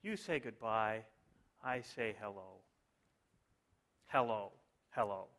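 hushed, low, solemn verse; mock-moved lyric recital. Preacher reciting Beatles lyrics to congregation, softly mock-solemn. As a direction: drop low and soft, as if deeply moved. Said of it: "I hope you're as moved by these".

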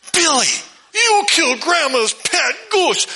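put-on a little girl scolding in horror; shocked big sister. Sister catches brother killing goose.

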